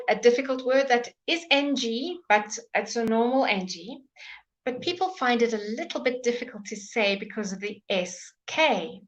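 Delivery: usual speaking voice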